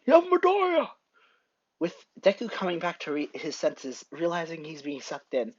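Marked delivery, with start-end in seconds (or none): 0.1-0.9 high, loud yell of alarm. Acted. All Might yells to his pupil in the fog, alarmed yell. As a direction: yell out to someone in danger. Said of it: "hears All Might yelling".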